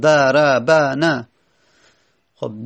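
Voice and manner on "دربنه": دربنه said slowly, every syllable da-ra-ba-na drawn out. Shown as a demonstration. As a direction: spell دربنه out slowly, each short vowel clear. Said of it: "چهار حرکت پشت سر هم". (آهسته و هجا به هجا)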